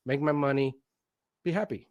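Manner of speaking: normal talking voice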